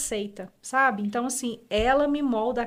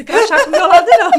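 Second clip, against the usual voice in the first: hammed-up annoyed mother, higher and louder, fast; mock irritated yell; 7 semitones higher, 15 dB louder, faster than usual. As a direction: shoo the badge away, half laughing. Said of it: "E ela falava". (mãe fingindo irritação, alto e rápido)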